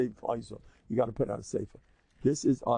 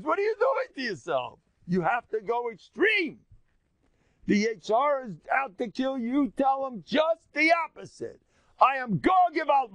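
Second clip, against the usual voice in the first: heated exhortation; 11 semitones higher than usual. Acted. Mock rebuke of self-abuse.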